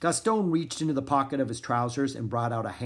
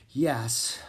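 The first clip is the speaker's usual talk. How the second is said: ordinary delivery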